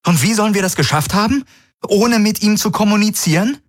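hoch, FÜNF schrill betont; bestimmt, mit verdeckter Furcht; bestimmt, selbstsicher. A narrator's reading. Kirai kontert spöttisch. (Kirai, hoch und bestimmt, Angst überspielend)

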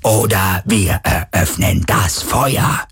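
slow electronic threat; menacing loudspeaker voice; booming amplified voice. A narrator's reading. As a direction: drone the ultimatum like a machine. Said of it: "dröhnte die Juhun-Stimme erneut".

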